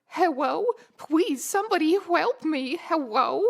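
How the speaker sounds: cutesy uwu voice turning l into w, hewwo; uwuified pleading. Acted. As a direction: plead in a cutesy baby-talk lisp. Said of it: "uwuified words".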